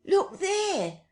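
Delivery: very high, loud shriek; old woman screams at the sight; piercing cry; sharp scream. A narrator's reading. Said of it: "screamed sharply".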